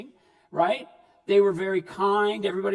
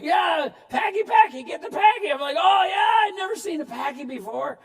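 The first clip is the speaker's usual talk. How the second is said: high, loud eager shout; gleeful shout, higher and louder; excited kid shouting; 7 semitones higher, 4 dB louder than usual. Retold or imitated. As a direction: yell it like a thrilled kid joining the hunt. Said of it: "I'd be like".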